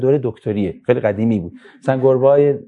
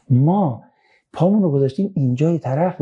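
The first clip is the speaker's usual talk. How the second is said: ordinary delivery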